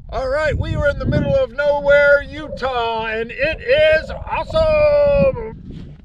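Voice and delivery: stretched, delighted exclaim; excited. Real, not acted. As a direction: shout it out to the open desert. Excited arrival in the desert.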